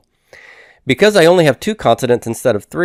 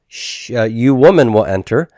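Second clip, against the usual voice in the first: normal talking voice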